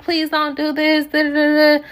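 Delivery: lightly voiced begging friend; a hint of a desperate friend; imitating plea. Friend pleading quoted.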